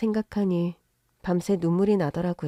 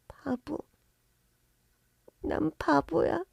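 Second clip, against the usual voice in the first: put-on soft childlike murmur; 7 semitones higher, 7 dB quieter, slower than usual. Daughter calls herself a fool.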